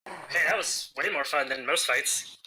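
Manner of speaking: ordinary talk, nothing marked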